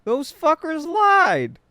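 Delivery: high, slow indignant cry; drawn-out high-pitched outrage; mock outrage. Real, not acted. Outraged at the packaging.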